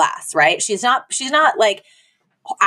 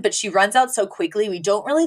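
ordinary delivery

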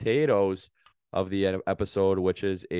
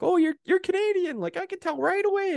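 very high mock squeal; squeaky-high imitation of gushing servers; mocking Americans. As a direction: parody an excited stranger in falsetto. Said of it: "They're like".

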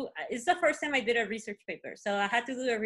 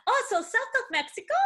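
imitating classmate; 10 semitones higher, 3 dB louder than usual. Classmate voiced, laughing.

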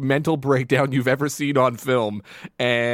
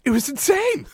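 excited; 13 semitones higher than usual. Real, not acted. Excited reaction.

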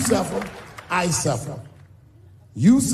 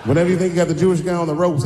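ordinary talk, nothing marked

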